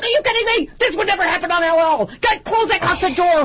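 high-pitched indignant telling-off; outraged rant. Retold or imitated. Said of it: "She went off".

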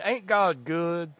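slow, drawn-out comic storyteller imitation. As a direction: stretch it out like an old stage routine. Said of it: "that old [name] routine".

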